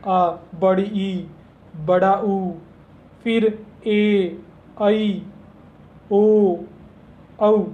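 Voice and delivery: आ, बड़ी ई, बड़ा ऊ drawn out slowly, each vowel held; slow vowel recitation for students; reciting long vowels. Shown as a demonstration. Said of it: "बाकी जो सात बच जाते हैं".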